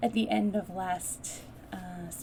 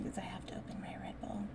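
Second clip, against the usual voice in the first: whispered aside; 8 dB quieter, much faster than usual. Real, not acted. Aside while opening a drink.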